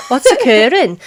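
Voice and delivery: laughing, a touch of Welsh accent; a light Welsh greeting. Shown as a demonstration. Host shows off her Welsh accent to cohost, laughing. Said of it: "I can do a Welsh accent".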